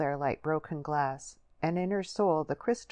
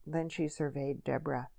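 ordinary delivery

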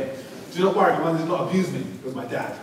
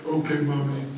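put-on deep little-boy voice. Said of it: "In a really deep bass voice".

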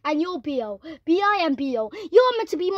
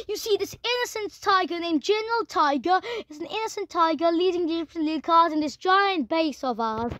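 ordinary talk, nothing marked

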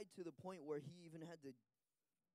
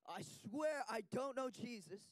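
slow, raised swearing; emphatic oath; 6 semitones higher, 4 dB louder, slower than usual. Retold or imitated. Interpreter voices Peter swearing, slow and raised. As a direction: drag out the oath.

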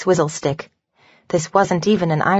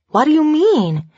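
sudden much higher, startled question; surprised; 10 semitones higher, 3 dB louder than usual. Acted. Sarah reacts on the phone to Emery's news, startled rise. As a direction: jump up in pitch, caught off guard. Shocked she couldn't fire them.

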